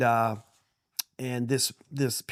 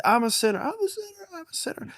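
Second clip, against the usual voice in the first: put-on father leads the dying kid, rising higher and fading to a breathy near-whisper; fading repeat. Father coaching a dying man to repeat, higher and fading. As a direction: start high, each repeat higher and fading away.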